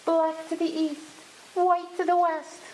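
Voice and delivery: high, chanting bird voice; sparrow call. A narrator's reading. Sparrows telling the girl the trick, in a high chant. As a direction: call it out high, almost on one note.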